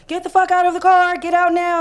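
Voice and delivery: very high, very loud imitated command; retold shouted order. Officer yelling again at the driver to get out, imitated shout. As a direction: yell it full out as the officer did.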